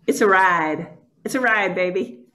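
usual speaking voice

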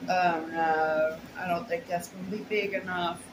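ordinary talk

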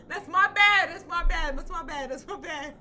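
high, giggling apology; laughing apology. Real, not acted. Gamer laughing sorry at viewers after a blunder.

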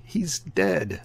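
a sailor's lightly voiced shocked cry; emotional screech. A narrator's reading. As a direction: sailor crying out on finding the mate, high and shocked. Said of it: "screeched out emotionally".

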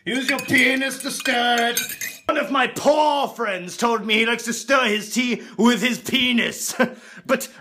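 pompous upper-class toff voice; hammed-up snooty aristocrat; posh rich man. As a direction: lord it over the poor peasants. Posh rich man character throughout.

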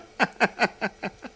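short run of high-pitched laughter; brief high giggle. Acted. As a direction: giggle high in quick pulses. Laughing at his own initials.

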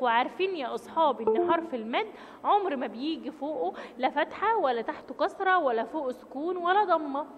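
normal talking voice

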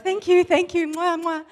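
high, loud flurry of thanks and air kisses; embarrassed gushing. Retold or imitated. Patient thanking the lady, embarrassed gushing. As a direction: overdo the thanks in a fluster. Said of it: "you almost don't know what to do. Embarrassed".

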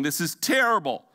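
a hint of a tearful wife; mock crying wife. Pastor retelling a wife crying over flowers, mild mock sob. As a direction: whimper it lightly. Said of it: "she's crying".

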